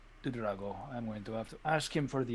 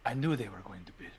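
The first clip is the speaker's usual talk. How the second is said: hushed suspicion; 9 semitones lower, 8 dB quieter, faster than usual. Acted. Plotting betrayal, hushed confession.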